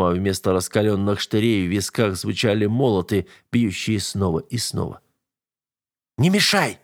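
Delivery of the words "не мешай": high-pitched irritable snap; curt, annoyed brush-off; snapped retort. A narrator's reading. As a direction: snap at someone interfering. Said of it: "огрызнулся Айк".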